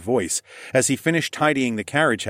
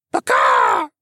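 very high, loud squawked shout; bird holler; 6 dB louder than usual. A narrator's reading. Bird hollering after the shiny prize, high squawk. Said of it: "Igoric hollered".

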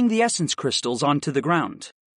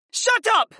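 very high-pitched outburst; high, furious schoolboy retort; spat angrily. A narrator's reading. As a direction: snap it in a high boy's voice. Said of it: "Simon spat".

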